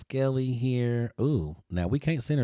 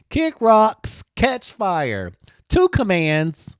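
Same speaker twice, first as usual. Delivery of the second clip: higher, louder sassy brush-off; sassy dismissal; 7 semitones higher, 8 dB louder, slower than usual. Acted. Designer performing the shirt slogan to viewers, sassy and loud. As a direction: tell them off with attitude.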